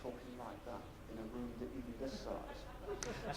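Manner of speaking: soft Gandhi-style speaking demo; very quiet demo. Shown as a demonstration. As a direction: drop nearly to nothing to show how hard it is to hear. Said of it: "wouldn't get away with talking like that".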